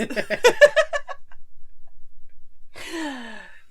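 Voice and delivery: high, loud burst of real laughter. Real, not acted. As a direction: crack up loudly. Laughing at refusing the name.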